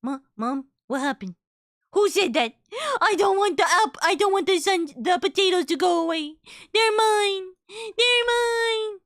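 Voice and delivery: high, pleading little creature whine; a toddler pet begging its mom; childlike pet voice. Retold or imitated. Pet whining to mom about its potatoes, high and pleading. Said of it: "Immediately throws eyebrows".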